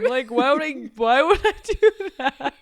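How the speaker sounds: loud protest dissolving into laughter; laughing, incredulous retort; mock indignation. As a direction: imitate appalled disbelief while amused. Sister's indignant reply retold.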